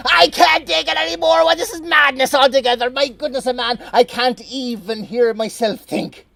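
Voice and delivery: high and loud exasperated rant; cranky farmer at the end of his tether; furious Atty. Acted. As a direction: boil over at the noise. Atty losing patience again.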